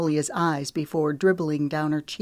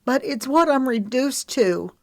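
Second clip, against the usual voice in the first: vulnerable; 9 semitones higher, 4 dB louder than usual. A narrator's reading. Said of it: "The vulnerable note in Magnolia's voice".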